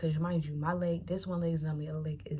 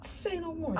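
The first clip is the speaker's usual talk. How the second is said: eager agreement; 12 semitones higher, slower than usual. Retold or imitated. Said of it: "And I'm like".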